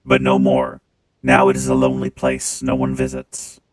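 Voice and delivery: deep, loud clanging machine voice; clangy robot voice. Acted. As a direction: speak through a booming robot filter. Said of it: "when I turn on the robot voice".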